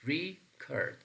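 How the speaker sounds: "record" broken up, high REE, then cord; stress on first syllable. Shown as a demonstration. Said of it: "place the stress pattern on the first syllable".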